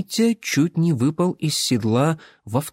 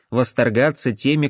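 ordinary delivery, nothing marked

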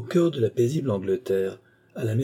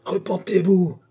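high, loud raving shout; screaming at his victims to repent; madman's cry; 10 semitones higher, 5 dB louder than usual. Acted. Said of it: "criait le fou".

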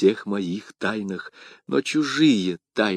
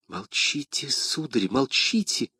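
soft, higher-pitched plea for silence; urgent hushing; 4 semitones higher, 7 dB quieter, slower than usual. A narrator's reading. Young woman silencing him as he names her, urgent hush.

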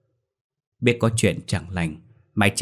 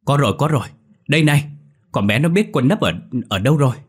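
báo tin to và cao giọng; gọi mọi người thật to; gọi lớn; 6 semitones higher, 5 dB louder, faster than usual. A narrator's reading. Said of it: "Lũ lên tiếng gọi lớn". (gọi lớn báo tin)

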